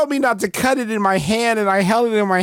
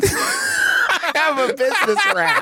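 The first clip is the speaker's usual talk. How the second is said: laughing; 9 semitones higher, slower than usual. Pretend. Joking voice, laughing.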